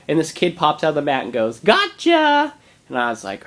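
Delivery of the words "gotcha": imitated high, loud child's shout; gleeful kid's surprise. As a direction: pop out of hiding like a kid. Kid pops out of hiding.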